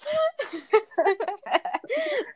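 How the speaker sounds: a long, high laugh. Real, not acted. Laughing with Gina over the beauty jab, high and long.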